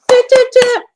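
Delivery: high, punchy horn toots; imitated toot. Mimics a horn before plug.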